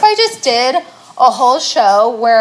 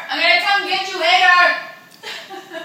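laughing threat; 5 semitones higher, slower than usual. Pretend. Playful threat repeated, laughing.

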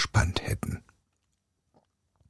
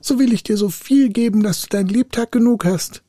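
high, urgent spirit voice; high-pitched begging captive; pleading spirit; 11 semitones higher, 3 dB louder than usual. A narrator's reading. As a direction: call out desperately to bargain. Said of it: "rief der Geist".

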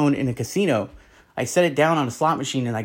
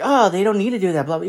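higher, dismissive mock voice; mocking the Young Turks; 5 semitones higher, faster than usual. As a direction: sneer it like a smug pundit. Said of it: "the Young Turks were like".

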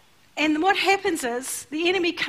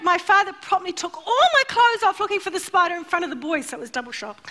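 ordinary talk

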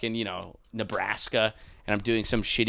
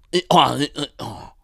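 normal talking voice

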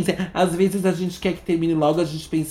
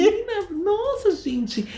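mock squeaky voice; slower than usual. Mocking embarrassed high voice.

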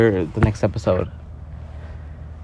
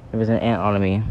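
ordinary delivery

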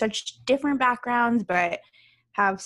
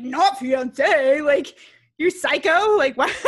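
put-on indignant boyfriend; 4 semitones higher, 7 dB louder, slower than usual. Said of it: "my boyfriend was like".

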